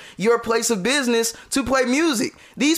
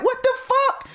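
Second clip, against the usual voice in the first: high pitched disbelief; shocked outburst. Retold or imitated. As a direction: squeal it in shock. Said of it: "I'm like".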